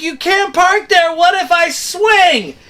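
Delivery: high, slow insistent call; retold protest. Sailor warning captain he might swing into him, a high slow retold protest. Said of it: "I was like".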